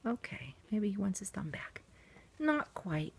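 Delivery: soft unvoiced whisper. Real, not acted. Whispering over the sleeping doll.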